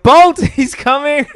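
high, slow drawn-out yell. Retold or imitated. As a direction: call it out loud and high. Retold group yelling a name.

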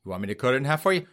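very fast offer; husband rushing. Retold or imitated. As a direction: blurt the offer out quickly.

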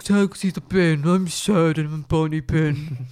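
a light mopey drone, slowing down; lightly voiced sad bin; mopey Eeyore bin. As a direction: sigh it like a gloomy donkey. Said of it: "a bit of the Eeyore character".

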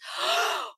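big sarcastic gasp of delight; theatrical intake of breath; mock excited gasp. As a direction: gasp in exaggerated anticipation. Mock eagerness to see the child.

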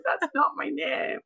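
laughing, pleading objection in a lifted voice; retold protest. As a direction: protest slowly through laughter. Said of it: "I was like".

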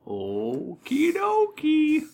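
very high, slow, sing-song; squeaky okie dokie. Acted. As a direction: chirp it like a cartoon. Cheery reply.

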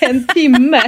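words through laughter; laughing. Real, not acted. Telling her co-host the delay, laughing.

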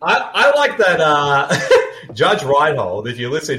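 ordinary delivery

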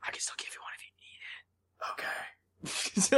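quick hushed whisper. Real, not acted. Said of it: "Why are we whispering?".

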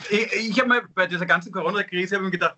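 normal talking voice

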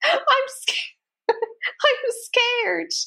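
very high, laughing mock whimper; mock scared. Mocking Kim cowering from the dog, high mock fear. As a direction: squeak it out like a frightened little girl. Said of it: "she's like".

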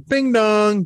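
sung high and bright like a doorbell; two-note doorbell ding dong; doorbell chime. Retold or imitated. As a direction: chime it out like the bell at the door. Imitating the DoorDash doorbell.

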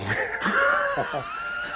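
high squealing burst of laughter; shrieking laugh. Real, not acted. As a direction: crack up in a shrill shriek. Burst of laughter mid scene.

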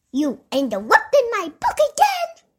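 high, loud play-acted telling-off; scolding Unicorn.

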